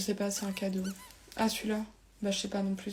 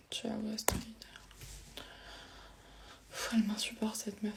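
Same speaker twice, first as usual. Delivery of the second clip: breathy whispered aside; whispering; 6 dB quieter than usual. Acted. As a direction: whisper it behind her back. Unvoiced whispered aside about Astrid.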